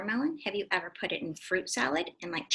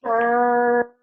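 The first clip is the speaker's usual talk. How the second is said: loud burp word; 11 dB louder than usual. Shown as a demonstration. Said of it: "He burps a big, giant burp".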